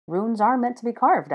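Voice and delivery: usual speaking voice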